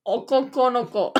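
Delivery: okoko no ko in a put-on anime voice, falling at the end. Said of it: "I'll say 'em in my anime voice".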